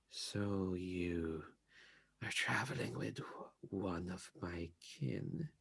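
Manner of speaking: put-on soft, cold, questioning old woman; hushed and wary, stone cold; quiet stern bee lady.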